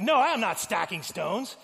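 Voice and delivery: loud, high, proud correction; hammed-up indignant worker; indignant denial. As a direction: deny it with theatrical offence.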